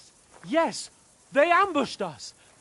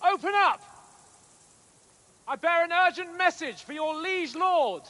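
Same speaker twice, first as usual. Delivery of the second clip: calling out; 6 semitones higher, 4 dB louder than usual. Acted. Calling up to the closed castle gate.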